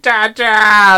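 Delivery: high, loud, stretched "ta-da"; mock triumphant cry. Dad imitating his snow-covered daughter, triumphant cry. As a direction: imitate a kid popping up triumphant.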